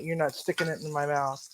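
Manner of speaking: normal talking voice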